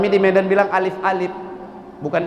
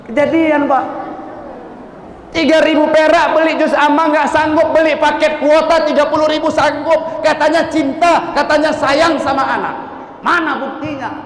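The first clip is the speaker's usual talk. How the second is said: raised, forceful sermon voice; fiery preaching; 9 semitones higher than usual. Acted. Preacher scolding parents who will not pay for lessons, fiery sermon. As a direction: rebuke the congregation with full force.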